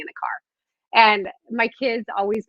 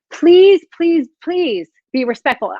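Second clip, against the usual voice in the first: pleading; 8 semitones higher, 5 dB louder, slower than usual. Real, not acted. Pleading with the live comments.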